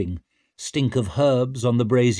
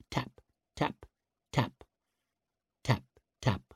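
soft tapping; 8 dB quieter than usual. A narrator's reading. Tattoo needle sound.

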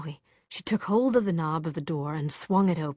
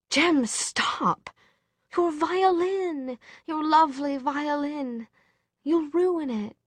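much higher, drawn-out pleading cry; distressed plea; 9 semitones higher, slower than usual. A narrator's reading. Tessa begs Jem to stop ruining his violin, distressed. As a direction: beg urgently, voice pitched right up.

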